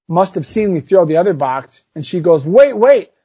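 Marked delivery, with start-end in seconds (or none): 2.5-3.1 high, louder eager call out; eager kid call. Retold or imitated. Susie finding the thrown box, eager high call. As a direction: yelp it like a kid who found something.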